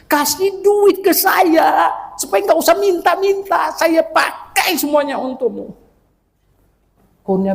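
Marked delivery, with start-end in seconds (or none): 0.0-5.7 imitated shouted prayer. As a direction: cry out to God, hammed-up and high. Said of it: "saya teriak". (teriakan doa yang dibuat-buat, tinggi dan keras)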